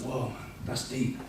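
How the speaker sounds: soft, stunned retold reaction; hushed awe. Newcomer told he has experienced love, hushed awe.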